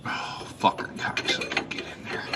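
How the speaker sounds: breathless strained whisper under effort; whispered cursing. Real, not acted. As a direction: hiss the curses while straining. Straining to fit part, cursing.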